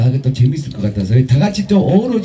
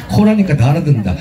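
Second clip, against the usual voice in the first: usual speaking voice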